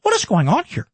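a hint of an outraged talk-show host; Tucker impression. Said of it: "That's my Tucker impression".

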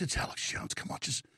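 hushed, breathy aside; mock whisper. Lawyer's hushed aside to Stephanopoulos, whispered. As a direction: whisper it like a confidant.

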